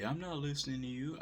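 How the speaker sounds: a light mocking brush-off; mock dismissive retort. Quoting a retort he never said.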